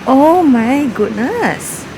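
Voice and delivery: slow, loud amazed gasp of words. Real, not acted. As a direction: stretch it out in wonder. Amazed at the view.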